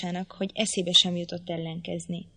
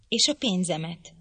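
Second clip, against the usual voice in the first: ordinary delivery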